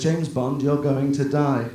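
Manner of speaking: ordinary talk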